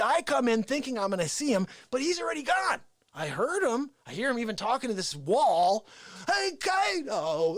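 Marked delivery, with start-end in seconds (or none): 6.3-7.6 slow hammed-up call, high and far off; Face impression. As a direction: call out to a wall like a madman. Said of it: "I don't do a good, uh, Face impression".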